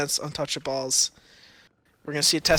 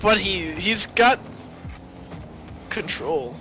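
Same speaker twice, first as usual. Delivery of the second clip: tripping over the words; stuttering; 2 semitones higher, slower than usual. Real, not acted. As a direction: stammer before the last word. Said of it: "you're stuttering on control".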